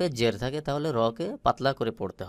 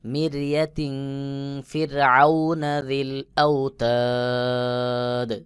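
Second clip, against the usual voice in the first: melodic recitation chant; Quran recitation; 3 semitones higher, 4 dB louder, slower than usual. Shown as a demonstration. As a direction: intone the verse slowly and tunefully.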